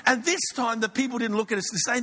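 ordinary talk

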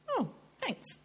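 high, soft little thank-you; reply in a small high voice; polite surprise. Retold or imitated. Speaker retelling her reply to the hairdresser's compliment, politely surprised.

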